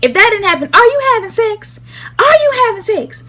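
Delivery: high, loud demanding mom voice; mom's angry interrogation. Retold or imitated. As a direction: grill her like an angry mother. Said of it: "she was like".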